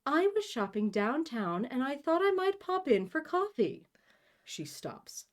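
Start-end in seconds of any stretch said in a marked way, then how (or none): 0.1-3.9 high, theatrical society mother; mother's society voice. Acted. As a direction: chat airily as a high-voiced grand lady. Mother's put-on voice in story.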